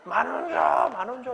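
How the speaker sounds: loud, strained mock demand jumping high; child throwing tantrum. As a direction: whine and demand like a stamping kid. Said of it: "이러면서 막 발도 치고".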